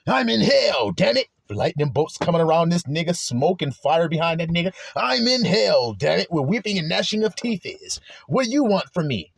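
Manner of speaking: hammed-up shout about being in hell; preacher acting out a furious sufferer; ranting in misery. Voicing a bitter person in misery.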